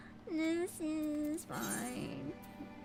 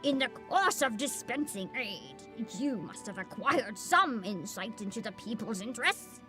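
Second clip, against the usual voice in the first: put-on old man voice; 6 semitones lower, 4 dB quieter than usual. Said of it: "You love my old voice".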